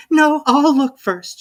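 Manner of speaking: put-on a careful girl insisting; Alice's voice. A narrator's reading. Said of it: "she said".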